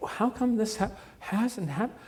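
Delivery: high-pitched mock bewilderment; feigned confusion. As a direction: act innocently baffled instead of screaming, voice lifted high. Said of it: "she almost feigns, like, uh, being a little".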